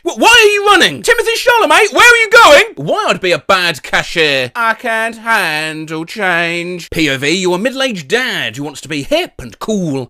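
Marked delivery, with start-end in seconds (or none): 0.0-2.7 high, loud calling after the dog; panicked calling. Acted. Dog runs off. 4.5-6.9 slow deadpan. Acted. Cashier pun.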